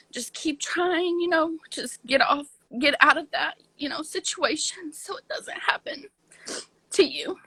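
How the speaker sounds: fighting back tears mid-sentence; holding back sobs. Real, not acted. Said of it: "So I'm sorry I get emotional".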